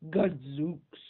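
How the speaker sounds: startled bear gasp; shocked gasping exclamation. Acted. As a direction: gasp it in horror. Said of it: "gasped the bear".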